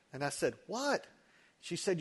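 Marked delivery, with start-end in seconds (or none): 0.7-1.1 high-pitched startled question; mock bewilderment, pitched well up; baffled. Husband answering his hallucinating wife, baffled.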